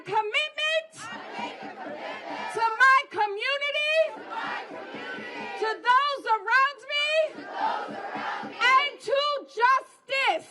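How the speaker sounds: rally pledge. Shown as a demonstration. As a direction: lead the pledge line by line. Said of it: "put your fist up and say".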